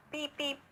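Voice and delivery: high, light beep-beep of a horn; car horn beeps. A narrator's reading. Narrator mimics a car horn below the tree. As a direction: toot like a little car.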